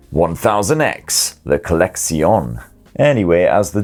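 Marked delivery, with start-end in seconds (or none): 0.1-2.6 slow mock-posh French, "Collection" ending in a nasal "-sion"; posh French accent. Shown as a demonstration. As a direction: drawl "the Collection" like a French luxury ad. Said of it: "makes me wanna put on a posh French accent".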